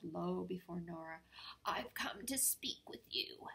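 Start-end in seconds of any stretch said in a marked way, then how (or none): 1.7-3.5 whisper. Acted. Peabody speaking timidly.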